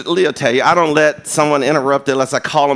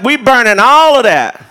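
loud declaration; 6 semitones higher, 6 dB louder than usual. Retold or imitated. Youth leader ordering burning.

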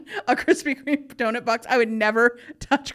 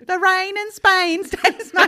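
put-on high, slow, loud British accent; exaggerated British vowels, stretched out; mock British accent; 7 semitones higher, 5 dB louder, slower than usual. Joining in the British accent bit.

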